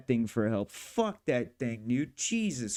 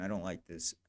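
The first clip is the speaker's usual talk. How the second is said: much lower and softer, half to himself; quiet disgruntled mutter, much lower; muttered under breath; 7 semitones lower, 9 dB quieter than usual. Real, not acted. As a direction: grumble it low and soft. Muttering at the editor.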